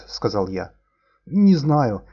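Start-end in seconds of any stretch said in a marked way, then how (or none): 1.2-2.1 gurgling. Acted. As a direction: wheeze it out through water. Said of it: "булькая хрипел инженер".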